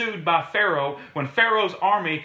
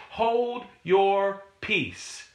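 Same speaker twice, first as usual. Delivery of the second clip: slow quote; 2 semitones higher, much slower than usual. Retold or imitated. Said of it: "I love how he says that".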